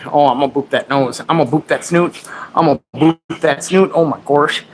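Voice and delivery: usual speaking voice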